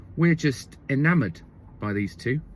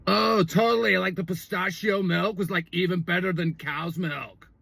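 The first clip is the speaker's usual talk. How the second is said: frat bro; 3 semitones higher than usual. Retold or imitated. Comedian mimicking a boyfriend on the beach, frat-bro voice. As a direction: imitate an easygoing frat bro agreeing. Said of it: "like, a sort of a frat bro".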